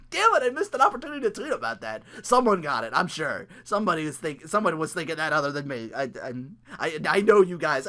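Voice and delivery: ordinary talk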